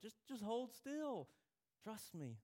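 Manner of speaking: put-on calm a squirming child; soothing parent. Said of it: "And they're like".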